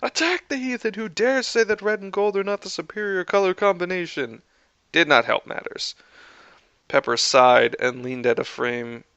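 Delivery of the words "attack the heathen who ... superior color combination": high-pitched villainous battle cry. A narrator's reading. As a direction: cry out orders to a minion army, pitched way up. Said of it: "Tony's cries of".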